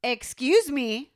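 slow, loud, drawn-out demand; with attitude. Shown as a demonstration. Showing her friend what she said to the intruder, with attitude. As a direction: stretch it out like a challenge. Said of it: "I said this. I said".

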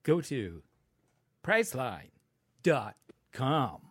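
halting ad pitch with big pauses; William Shatner. Retold or imitated. Podcaster mocking pauses in editing, halting delivery. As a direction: break the phrase into separate, punched pieces. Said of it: "it's like trying to edit for William Shatner".

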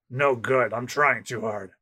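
low, soft, unhurried murmur; gruff navy captain muttering to himself; Smoker. Acted. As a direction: talk to yourself like a cool-headed captain.

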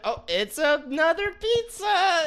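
high, slow mocking voice; drawn-out mock family line.

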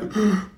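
quick acted gasp of fear; startled intake of breath; frightened gasp. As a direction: gasp as if seeing something scary. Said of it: "it created a fear in them".

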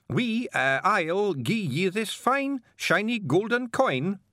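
high, slow, over-polite Scots; Feegle faking a gentleman. Acted. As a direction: put on airs to charm the carter.

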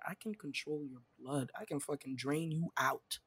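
soft, low mock threat; hushed, pretend-sinister boast; quiet menace. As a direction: play a blood bender, threatening under your breath. Imagines being a menacing blood bender.

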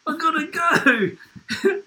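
high, slow, drawn-out pleading yell; high stretched-out cry of hurry up; mock desperate yell. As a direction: imitate a man bursting to pee, banging on the door. Coworker banging on toilet door.

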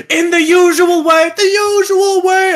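high, slow, loud ritual chant; mock challenge shout. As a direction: bellow the formal challenge. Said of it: "They're like".

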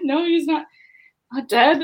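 disbelief. Retold or imitated. Disbelief at the news.

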